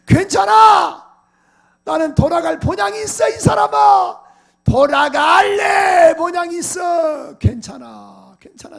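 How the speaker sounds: high, loud, drawn-out declaration; bold reassurance. Retold or imitated. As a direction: proclaim it to your worried wife. Retold bold reassurance to wife. (아내에게 큰소리로 당당하게 외치듯)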